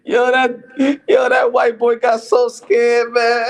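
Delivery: loud, high, laughing it out; laughing shout. Real, not acted. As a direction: crack up telling the payoff. Laughing at the story.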